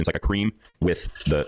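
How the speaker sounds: ordinary delivery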